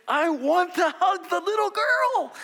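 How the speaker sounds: high, loud and slow, nearly squealing; overwhelmed delight. Retold or imitated. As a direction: gush like you could burst with joy. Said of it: "and I'm just like".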